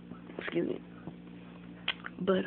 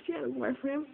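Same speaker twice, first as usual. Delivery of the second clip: high-pitched retold excuse; defensive fib; 6 semitones higher than usual. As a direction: offer the cover story high and insistent. Repeats the retold lie.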